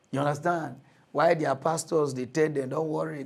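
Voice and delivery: ordinary talk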